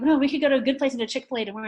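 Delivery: loud, high, rushed objection; acted bargaining protest. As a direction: rush out a counter-offer to a stubborn kid. Mother bargaining in acted quarrel.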